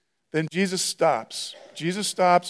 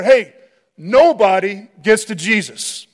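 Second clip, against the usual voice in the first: loud, slow radio order from a guard; 2 semitones higher, 6 dB louder, slower than usual. Acted. Security guard radioing the team, loud order. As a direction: bark it into a wireless mic like security.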